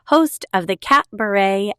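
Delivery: ordinary delivery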